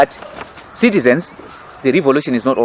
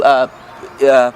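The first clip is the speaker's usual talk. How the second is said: normal talking voice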